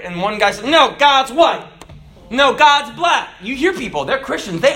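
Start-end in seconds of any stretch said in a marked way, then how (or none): 0.7-1.6 raised, insistent retort; mock argument. Two Christians arguing. 2.3-3.3 raised, insistent comeback; mock argument. Two Christians arguing.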